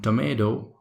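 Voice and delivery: tomato said American, "to-MAY-do"; American accent. Shown as a demonstration. Trainer sets American take against British, American accent. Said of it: "accent is called tomato".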